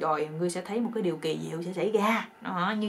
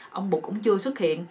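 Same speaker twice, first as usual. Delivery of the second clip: ordinary delivery, nothing marked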